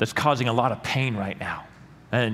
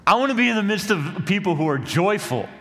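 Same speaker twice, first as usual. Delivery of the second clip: impassioned cry; 10 semitones higher, 5 dB louder than usual. Real, not acted. Longing for joyful church.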